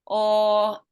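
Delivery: ordinary talk, nothing marked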